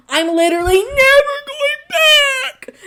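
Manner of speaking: high, loud, wailing declaration; hammed-up vow never to return; dramatic vow. Real, not acted.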